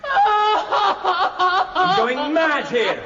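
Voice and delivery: slow, drawn-out exasperated shout with a laugh. Acted. Husband fed up with his wife's habits, shouting. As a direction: let the frustration burst out.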